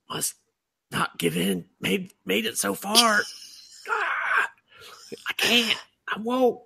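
high, strangled mock crying; fighting back tears. Viewer struggling through a sad episode, mock fighting tears. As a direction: squeak it out, hammed-up struggle not to cry. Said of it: "moments where I probably would have cried".

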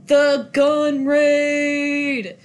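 loud, drawn-out announcer call; stretched-out, mock-epic shout; hyped announcement. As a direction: belt it out slowly like an event title. Announcing the big action scene.

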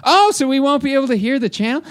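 high, loud mock-clueless tourist; loud Barb. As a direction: ask loudly, missing the point. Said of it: "so loud all the time".

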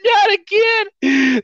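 kidnapped freshman protesting, high and drawn-out; kidnapped kid whining. Retold or imitated. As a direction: wail it like a fed-up freshman. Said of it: "And this kid's like".

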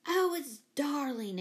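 high, slow gush; lifted, drawn-out enthusiasm; delighted exclamation. A narrator's reading. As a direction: exclaim it, drawing the words out. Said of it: "exclaimed Jane".

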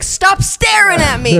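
high, drawn-out mock outburst; hammed-up indignant New Yorker; New York attitude. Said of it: "dude, that's New York, man".

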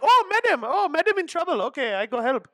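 put-on high, eager Indian accent. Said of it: "you doing the accents".

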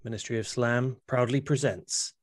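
lightly done hushed announcer intro. Pretend. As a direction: present it like a low-key announcer. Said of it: "very serious wrestling promo".

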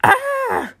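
a short angry growling cry; frustrated growl. A narrator's reading. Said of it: "almost a growl".

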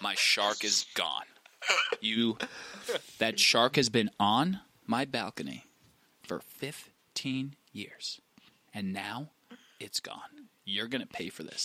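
slow, hushed, low threat with long pauses; quiet menace. Retold or imitated. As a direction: imitate a devastated man barely containing fury. Acts owner's quiet menace.

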